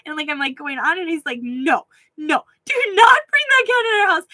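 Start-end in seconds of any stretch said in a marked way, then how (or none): 1.4-4.3 mock stern husband. Imitating husband forbidding the cat.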